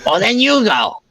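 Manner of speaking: very high character voice; indignant retort. Acted.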